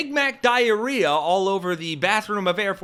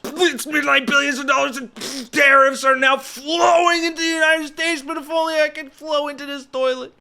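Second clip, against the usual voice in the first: much higher, hammed-up ranting Trump voice; Trump in all caps; 8 semitones higher, 4 dB louder than usual. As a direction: rant it out loud like an all-caps post. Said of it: "All caps".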